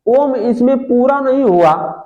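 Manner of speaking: ordinary talk, nothing marked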